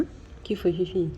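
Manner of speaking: soft whisper to the kitten. Real, not acted. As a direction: whisper gently. (sussurro suave para a gatinha)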